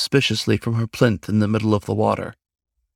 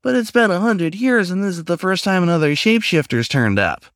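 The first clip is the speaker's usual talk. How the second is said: much higher, squeakier as it pleads; squeaky pleading; 8 semitones higher than usual. A narrator's reading. Raccoon pleading with the stranger to stay, squeaky and higher. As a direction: beg him to stay, voice rising thin. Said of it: "voice got squeakier the closer it got to pleading".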